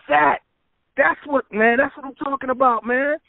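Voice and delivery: high-pitched, enthusiastic quote; excited approval. Retold or imitated. Singer hears the producer's track, excited approval. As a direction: react as if the track just hit you.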